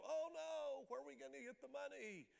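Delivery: high, fast panicky cry; mock panic. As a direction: squeal it out in alarm. Imagined panic over carpet money.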